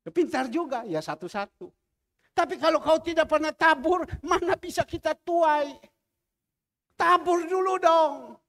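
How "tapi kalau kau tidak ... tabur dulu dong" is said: louder, pressing sermon voice; forceful preaching. Real, not acted. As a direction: drive the point home to the flock. (khotbah dengan suara keras dan mendesak)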